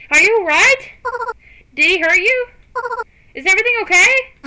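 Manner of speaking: high, loud, anxious questions tumbling out fast; rushing to her side, frantic about her safety; worried rush. Acted. Rushing to Aya's side, asking if she's hurt, frantic and loud.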